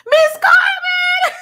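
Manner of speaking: high, slow, squealed greeting; a long, delighted cry of a viewer's name; excited shout-out. Real, not acted. Streamer squealing a welcome to a new viewer.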